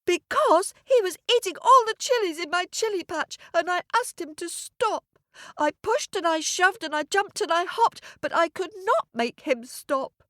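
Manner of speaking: put-on very high child voice, rushing at first; upset little boy. Boy explains to the cow about the ram, in high distress. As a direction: pour it out as an indignant small boy.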